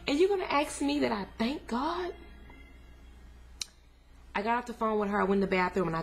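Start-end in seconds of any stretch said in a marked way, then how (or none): 0.1-2.1 high, stunned disbelief at the question; incredulous outrage. Retold or imitated. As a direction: throw the question back, voice cracking up high. Outraged retelling to sister's mom.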